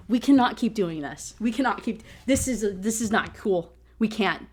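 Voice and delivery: ordinary talk, nothing marked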